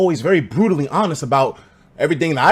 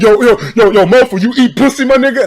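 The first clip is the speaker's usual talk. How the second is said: higher, louder taunting call to a classmate; mock hype bully; 6 semitones higher, 7 dB louder than usual. Asshole classmate taunting me at lunch, loud mock hype. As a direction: hype teasing bro voice. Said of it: "he's like".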